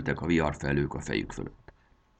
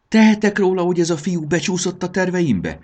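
high, loud scolding; snapping angrily; 11 semitones higher, 6 dB louder than usual. A narrator's reading. Módusz rounds on the grumbler, snapping angrily. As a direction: snap back, starting loud and very high. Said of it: "ripakodott rá Módusz".

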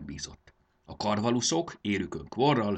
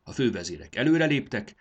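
ordinary delivery, nothing marked

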